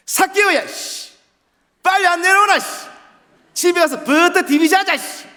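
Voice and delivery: very loud, very high Busan dialect; exaggerated, rough Busan shouting. Shown as a demonstration. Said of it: "부산 말투는 더 심하죠".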